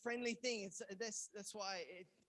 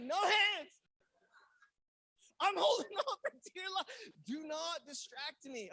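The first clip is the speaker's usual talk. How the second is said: very loud, high-pitched yell; panicked shout; 7 semitones higher, 10 dB louder than usual. Retold or imitated. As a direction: shout it as if hanging on for dear life. Panicked refusal on the ride.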